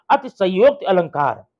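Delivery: ordinary delivery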